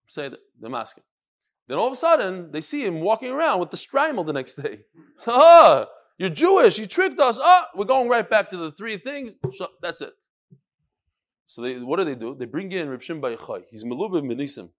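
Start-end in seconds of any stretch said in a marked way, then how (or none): 5.2-9.4 louder and higher, accusing; outraged shout. Acted. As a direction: catch the trickster red-handed, shouting. Romans catch him out.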